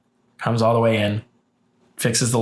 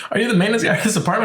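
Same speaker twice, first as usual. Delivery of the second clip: higher and fast, incredulous; exasperated; 9 semitones higher, much faster than usual. Retold or imitated. Questioning the maintenance man about stairs, higher and fast in disbelief. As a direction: rattle it off in disbelief.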